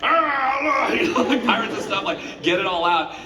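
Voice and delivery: pirate shout. Retold or imitated. Shouting like recording One Piece.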